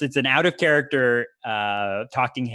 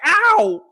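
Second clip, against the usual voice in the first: very high, loud yelp of pain; acted "ow"; 8 dB louder than usual. Wrestler takes a thud against the face, acted loud yelp. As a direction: yelp as the blow lands. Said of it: "but you're like".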